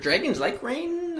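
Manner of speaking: usual speaking voice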